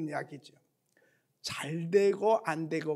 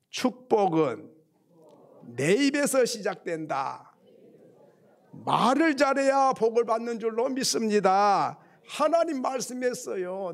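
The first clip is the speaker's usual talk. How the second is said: emphatic, loud preaching; forceful raised sermon delivery; 4 semitones higher, 6 dB louder than usual. Real, not acted. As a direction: drive each phrase home to the congregation.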